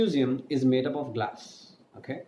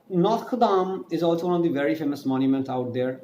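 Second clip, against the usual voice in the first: ordinary talk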